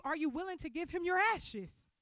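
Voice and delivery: ordinary talk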